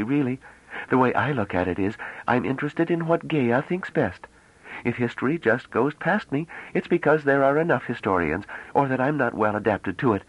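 put-on high girlish voice, running on without pause; rapid chatter. A narrator's reading. Bliss chattering to the men, high and breathless. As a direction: babble on eagerly without stopping for breath. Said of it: "She was speaking rapidly, almost breathlessly".

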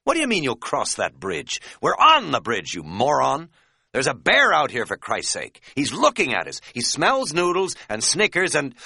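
loud, high, frantic tirade; panicked exasperation. A narrator's reading. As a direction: yell at a maddeningly calm friend. Panicked argument about a bear.

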